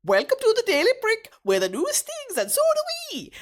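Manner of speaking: high, loud jingle-style intro read; news intro voice. Pretend. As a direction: announce it like a cheeky news show. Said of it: "I'll go again".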